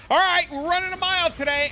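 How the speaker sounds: high, loud mock coach bark; bossy coach announcing the mile; imitating gym teacher. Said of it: "the fucking gym teacher would be like".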